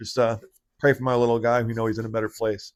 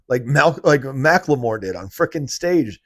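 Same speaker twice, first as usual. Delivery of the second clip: ordinary talk, nothing marked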